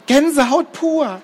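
very high, swooning squeak; gushing Gerti. Acted. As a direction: gush like a starstruck fan. Gerti gushes about singing.

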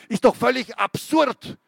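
high, loud scornful scream. Acted. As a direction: scream it as you leap up. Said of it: "schrie Renker".

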